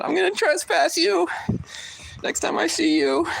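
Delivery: very high mocking voice; mock whiny guy. Mocking the store guy's threat, in a high squeaky voice. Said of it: "He said".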